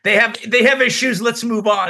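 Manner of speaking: quick, dismissive shrug of a line; mock hurrying past the problem; dismissive brush-off. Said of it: "It wasn't like".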